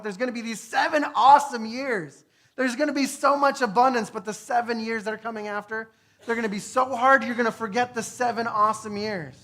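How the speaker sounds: preaching Joseph's warning with force; urgent prophecy. Acted. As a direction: proclaim it like a prophet.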